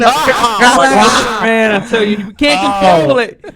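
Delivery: high, folksy fake small-talk voice; fake neighbor talk. Friend chatting with his neighbor, high folksy fake voice. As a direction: do the phony friendly neighbor chat. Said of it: "Weezy talking about".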